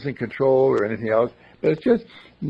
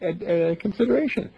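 ordinary delivery

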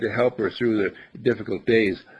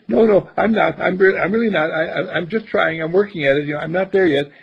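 higher, hurried mock protest of modesty; humble and contrite; 5 semitones higher, 3 dB louder than usual. Brother acting the humble reply his sister wanted, contrite. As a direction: play flustered humility, pitched up. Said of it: "humble and contrite and say".

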